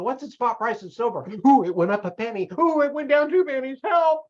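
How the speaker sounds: hammed-up nervous coin watcher; mock panic. Silver buyer checking prices all day, mock panicking. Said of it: "I'll be up on the".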